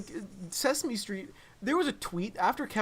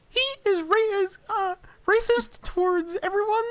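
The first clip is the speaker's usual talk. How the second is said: very high, loud diva pig; Miss Piggy voice; 13 semitones higher, 6 dB louder than usual. Retold or imitated. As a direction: proclaim like a puppet diva. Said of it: "Miss Piggy comes out".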